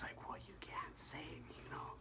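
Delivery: hushed breathy whisper throughout. Shown as a demonstration. Comic showing how careful voices shrink, whispering. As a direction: drop to a cautious whisper.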